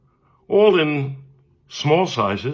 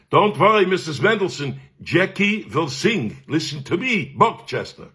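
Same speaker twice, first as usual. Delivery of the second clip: higher-pitched, emphatic Viennese maestro; Viennese accent; 6 semitones higher than usual. Retold or imitated. Teacher promising the mother Jackie will sing, Viennese accent. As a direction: imitate a self-assured teacher with a Viennese accent. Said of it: "He was my Viennese cantorial teacher".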